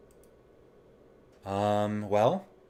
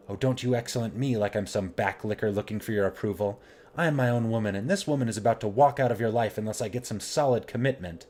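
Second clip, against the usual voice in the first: louder, haughty outburst; indignant tirade; 3 semitones higher, 6 dB louder than usual. Pretend. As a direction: refuse to be patronised. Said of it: "Just let it all out".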